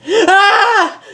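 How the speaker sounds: high startled scream with a laugh; waking scream. Acted. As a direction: shriek as she jolts awake.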